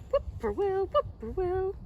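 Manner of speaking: high, lilting call; high sing-song whippoorwill. Acted. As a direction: sing the bird's name as its song. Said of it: "has its favorite twir-, trill".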